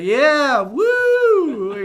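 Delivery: high, loud whooping cheer; cheering crowd. Retold or imitated. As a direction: cheer like a hyped studio audience. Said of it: "the audience being like".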